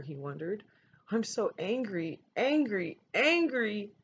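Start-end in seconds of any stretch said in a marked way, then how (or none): 1.1-3.9 building anger. A narrator's reading. Child alone, furious, repeating.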